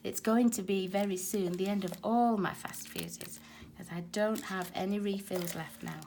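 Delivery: ordinary delivery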